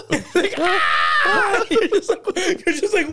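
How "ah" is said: high, goofy yell; sudden high-pitched silly scream; mock cartoon scream. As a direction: shriek as a comic interruption. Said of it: "hard cuts".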